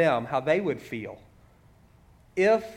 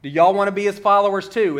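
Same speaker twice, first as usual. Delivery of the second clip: higher, louder sarcastic comeback; frustrated sarcasm; 6 semitones higher, 7 dB louder than usual. Retold or imitated. Healed man answers the experts questioning him, with frustrated sarcasm. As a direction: let exasperated sarcasm seep into the retort. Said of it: "hear the sarcasm kinda seeping".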